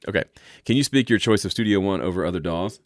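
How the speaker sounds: usual speaking voice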